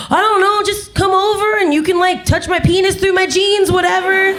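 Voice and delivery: high, careless mock young-guy voice; twenty-something guy. Twenty-something guy lazily inviting a date over, high and offhand. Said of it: "guys in their twenties are just like".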